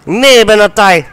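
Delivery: furious high yell at the screen; angry shout. Real, not acted. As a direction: scream it in frustration. Gamer raging at game.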